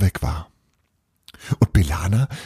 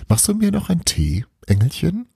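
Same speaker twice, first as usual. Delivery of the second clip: much higher, sugary wheedling mother; mother asking her daughter sweetly for tea, much higher; sweet coaxing; 11 semitones higher than usual. Acted.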